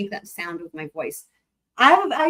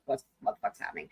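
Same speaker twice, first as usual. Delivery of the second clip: quick, breathy whispered question; confused whisper; 8 semitones lower, 11 dB quieter, faster than usual. Retold or imitated. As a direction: whisper it like a lost viewer. Voicing a confused viewer.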